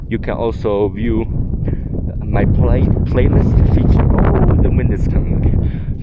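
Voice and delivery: out of breath, pushing words out loud; gasping between phrases in thin mountain air; breathless at high altitude. Real, not acted. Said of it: "I'm running out of breath".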